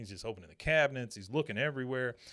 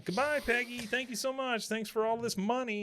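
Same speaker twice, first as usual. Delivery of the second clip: high-pitched mock farewell; gleeful taunt; 12 semitones higher than usual. Doctor leaving Peggy with the cash, gleefully taunting. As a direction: gloat in a high voice while leaving. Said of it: "he's just laughing".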